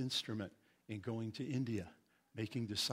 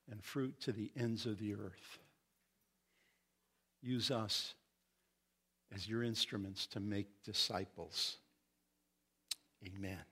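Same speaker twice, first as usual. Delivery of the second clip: prayer; 2 semitones lower than usual. Real, not acted. Pastor asking the Lord to help the church, prayer. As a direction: plead gently and reverently, phrase by phrase.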